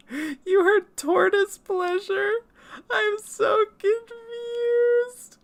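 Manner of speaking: very high, slow squeaky whine; mock confusion. Streamer reacting to chat's comment, high slow mock whine. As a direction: squeal your bewilderment in falsetto.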